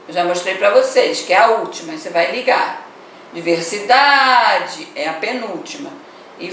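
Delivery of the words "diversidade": Diversidade with a long, held DA; stressed DA drawn out. Shown as a demonstration. Teacher showing pupils the penultimate syllable, DA drawn out in Diversidade. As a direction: stretch the DA in Diversidade. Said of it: "sílaba pronunciada com mais força".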